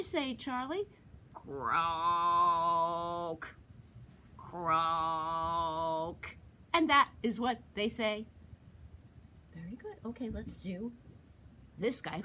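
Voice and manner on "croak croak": deep drawn-out croak; low toad croak. Shown as a demonstration. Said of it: "what do they say".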